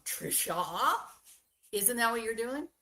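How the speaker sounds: ordinary delivery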